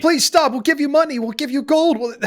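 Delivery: high, pleading captive voice; pleading elf parents. Acted. Mother begs Moog.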